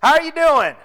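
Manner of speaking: hollering hello across a parking lot; shouted greeting. Retold or imitated. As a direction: call it out loud across the lot. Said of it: "I heard one shout to the other".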